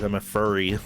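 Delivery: ordinary talk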